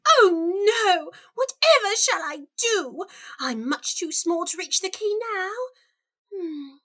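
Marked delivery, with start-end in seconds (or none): 0.1-3.0 put-on little girl crying out in dismay; alarmed and fretful. Alice finds key out of reach.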